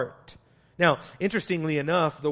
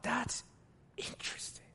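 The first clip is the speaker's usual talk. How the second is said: hushed wonder; 7 semitones higher, 13 dB quieter than usual. Retold or imitated. Awed inner thought about the angel.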